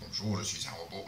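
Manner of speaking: lightly voiced talking toy robot; a hint of a robot greeting. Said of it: "on a un super robot".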